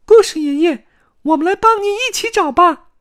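very high, loud eager squeak; piercing little character voice; shouting. A narrator's reading. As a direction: call out an offer of help. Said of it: "卡门喊道".

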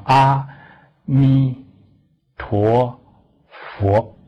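each syllable of 阿弥陀佛 drawn out slowly, one by one; teacher showing students how to recite, syllable by syllable slowly; slow, clear recitation. Shown as a demonstration. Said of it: "要念慢".

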